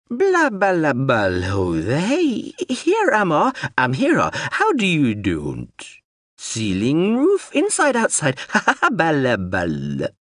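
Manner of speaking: slow, strange, singsong machine babble; a confused robot drone rambling; babbling drone voice. Acted.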